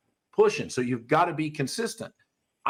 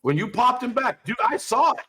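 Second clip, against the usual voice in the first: raised, louder, animated; emphatic; 7 semitones higher, 3 dB louder than usual. Real, not acted. Trainer hammering home a point to a student, higher and louder. Trainer scolding excitedly about correction.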